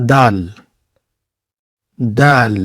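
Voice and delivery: دال with a soft d, as in دن; a gentle دال; soft Arabic dal. Shown as a demonstration. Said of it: "بالکل نرم".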